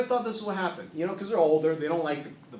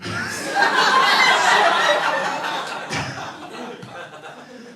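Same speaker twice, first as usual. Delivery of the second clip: laughing; 6 semitones higher, 5 dB louder than usual. Real, not acted. Long laugh after weird segue.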